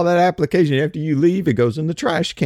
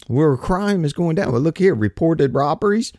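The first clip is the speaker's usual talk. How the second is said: ordinary talk, nothing marked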